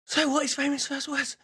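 high, strained host spiel; out of breath. Retold or imitated. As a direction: push the intro out as if puffed. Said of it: "I was so out of breath".

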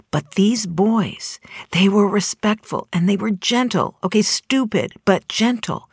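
usual speaking voice

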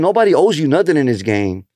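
ordinary delivery

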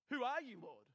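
loud, startled acted question; acting shaken by a booming voice; freaked out. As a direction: blurt it out, taken aback. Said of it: "and you're freaked out".

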